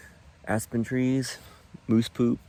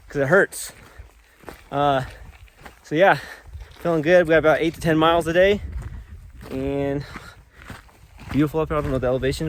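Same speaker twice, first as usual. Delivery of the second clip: ordinary talk, nothing marked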